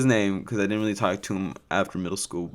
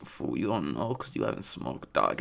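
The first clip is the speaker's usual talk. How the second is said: [name]; 7 semitones lower, 10 dB quieter than usual. Retold or imitated. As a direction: imitate a stoner homie's accent, quiet and low. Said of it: "I'm not just doing this accent".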